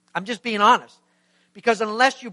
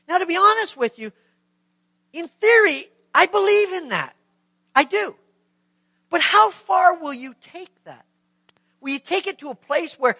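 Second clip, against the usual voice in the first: emphatic preaching; 8 semitones higher, 6 dB louder than usual. Acted. Preaching with rising force.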